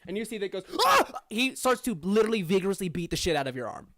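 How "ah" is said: high, loud scream of alarm; sudden panicked "ah"; startled yelp. Acted. Brother sees fire on Diego's arm, panicked yelp.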